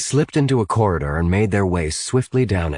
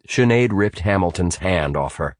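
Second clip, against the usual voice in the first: ordinary delivery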